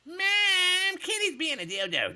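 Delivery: very high, loud whining yell to Mom; fat kid voice. Acted. Streamer doing a requested impression for chat, loud high fat-kid voice. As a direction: holler like a tattling kid, drawing out the first word. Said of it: "Can you do the fat kid's voice?".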